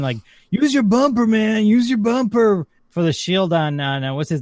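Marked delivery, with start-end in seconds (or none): 0.5-2.7 loud, high, insistent gamer yell. Retold or imitated. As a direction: shout it at a teammate over voice chat. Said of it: "they kept saying".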